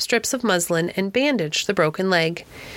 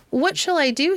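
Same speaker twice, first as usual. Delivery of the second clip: fretful question; 9 semitones higher than usual. A narrator's reading. Lady fretting over lost bird.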